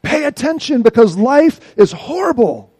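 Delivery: loud, high demanding plea; commanding God. Acted. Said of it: "It's a command to God".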